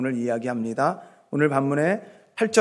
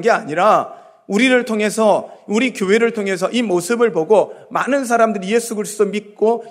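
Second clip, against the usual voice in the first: louder, higher sermon delivery; impassioned preaching; 5 semitones higher, 6 dB louder than usual. Real, not acted. Preacher urging congregation that many be saved through church, impassioned.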